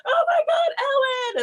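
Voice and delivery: high, laughing shriek of a fan; fan screaming. Retold or imitated. Fans falling over the fake Ellen, laughing shriek. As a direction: squeal as if meeting a star. Everybody meeting pretend Ellen.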